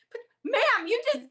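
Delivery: high, loud disbelieving cry; incredulous protest. Retold or imitated. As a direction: squeal it out in shocked disbelief. Horrified reply to host.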